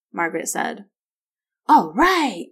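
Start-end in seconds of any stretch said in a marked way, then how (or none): 1.7-2.5 put-on high, loud boyish cheer. A narrator's reading. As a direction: whoop with delight at a treat. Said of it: "Will cheered".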